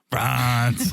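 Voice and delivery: France said the French way, nasal fron; imitating a French accent on France; a hint of fake French; mock French France. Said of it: "walking around the streets saying".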